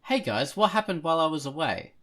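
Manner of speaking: usual speaking voice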